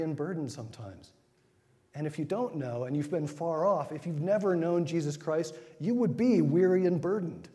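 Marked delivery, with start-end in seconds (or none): 5.8-7.5 raised preaching. Acted. Preaching climax.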